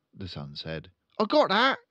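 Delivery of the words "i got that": put-on high, loud boyish reply; eager son. Son answering his father, high and keen.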